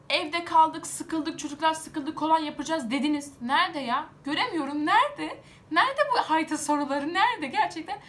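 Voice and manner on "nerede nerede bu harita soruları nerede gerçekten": high, loud, exasperated demand; furious shout. Real, not acted. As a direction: yell it at the ministry in real anger. Angry rant about exam questions. (sinirle yüksek sesle bağırarak)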